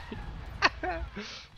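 breathy high-pitched giggle. Real, not acted. Laughing at game chaos.

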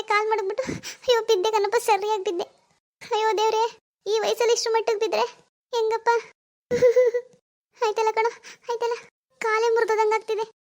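high-pitched wailing in pain; milk the fall with theatrical sobs; hammed-up tearful lament; put-on crying. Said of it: "ಕಾಲ್ ನೋವು ಅಂತ ಅಳ್ತಿದ್ದಾರೆ".

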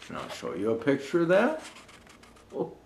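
plain reading voice

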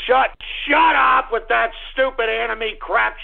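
normal talking voice

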